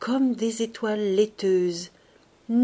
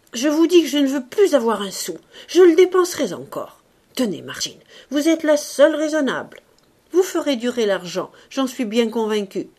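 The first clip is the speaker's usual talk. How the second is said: emphatic insistence; 2 semitones higher, 7 dB louder than usual. A narrator's reading. Man insists, then soothes her.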